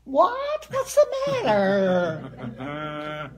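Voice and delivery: sky-high, loud, drawn-out mock yelling back; loud retort. Young daughter answering her mother, high loud drawn-out yell. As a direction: blast back at mother, oblivious. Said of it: "And I'd say".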